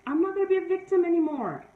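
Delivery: rising, firm vow to stop being a victim; resolute declaration. Retold or imitated. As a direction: declare it with new resolve, voice lifting. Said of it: "took accountability and said".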